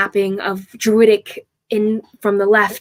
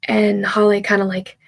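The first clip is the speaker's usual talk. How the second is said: usual speaking voice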